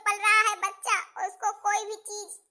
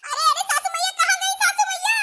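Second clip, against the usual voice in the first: louder, higher desperate yelling; pained yell; 4 semitones higher, 5 dB louder than usual. Acted. Daughter-in-law in labour yells for her mother-in-law.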